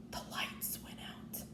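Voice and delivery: hushed, breathy storyteller whisper; spooky whisper. A narrator's reading. Narrator telling children the scary part, whispering. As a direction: drop to a whisper for suspense.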